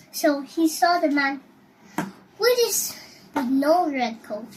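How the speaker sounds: plain reading voice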